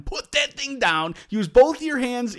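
slow, high, exasperated parental yell; mock dad shouting an order; retold scream. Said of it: "until I start screaming at him".

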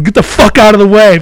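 loud, high-pitched angry yell; imitated yell. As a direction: yell it like a rider shoving through. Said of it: "He yells at me".

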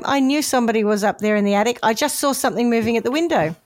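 ordinary talk, nothing marked

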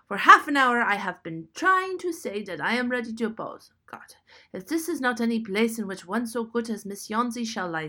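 put-on loud, gruff old German man; loud German-accented outburst; German accent yell. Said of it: "yelled Behrman".

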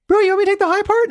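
very high, fast mock falsetto; imitating Craig's high voice. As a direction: squeak it out fast in a comic falsetto. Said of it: "He's got this voice".

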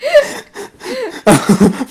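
high-pitched laugh breaking into a squeal; shrill burst of laughter after the voice. Real, not acted. Laughing at own bad accent.

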